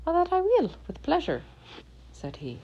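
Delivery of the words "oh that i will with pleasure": high little leprechaun voice. Acted. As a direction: play the cheeky little leprechaun, pitched up. Leprechaun answers Tom.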